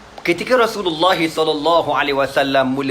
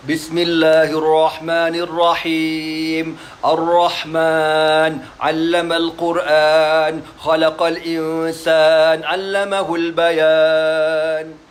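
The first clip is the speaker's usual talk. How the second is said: slow, drawn-out melodic recitation; beautiful Quran recitation; much slower than usual. Acted. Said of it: "Elok je dia baca". (bacaan Quran yang merdu dan perlahan)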